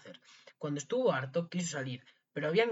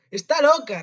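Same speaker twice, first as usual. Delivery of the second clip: high, loud exclamation; loud retort; 12 semitones higher, 15 dB louder, slower than usual. A narrator's reading. Priest answers the frantic maid, exclaiming loudly. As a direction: dismiss it at full volume.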